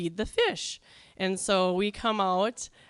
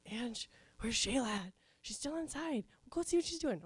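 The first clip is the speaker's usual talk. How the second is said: much softer, half-hidden call from the leaf pile; hushed call; 3 semitones higher, 9 dB quieter than usual. Retold or imitated. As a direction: kid hiding in leaves softly sending her sister to check. Hiding in leaves, calling softly.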